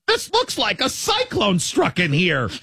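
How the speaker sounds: high, slow scolding drawl; exasperated mom voice. Retold or imitated. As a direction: mom stunned by the messy room. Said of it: "if it was in that voice".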